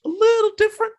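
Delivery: high teasing sing-song repeat; sing-song tease. Pretend. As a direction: stretch it up high, playfully. Teasing repeat, far higher.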